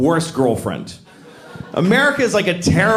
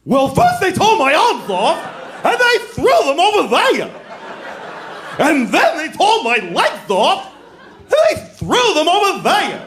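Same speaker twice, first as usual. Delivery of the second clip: over-the-top Scarecrow; 11 semitones higher, 3 dB louder than usual. Acted. Scarecrow's second take for the director, high hammed-up wail. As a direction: ham up the Scarecrow's misery. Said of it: "let him do another one".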